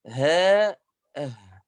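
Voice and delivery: أه with a faint airy ه; breathy letter demo. Shown as a demonstration. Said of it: "هذا الهواء ضعف في المخرج". (نطق الهاء الساكنة بالهمس)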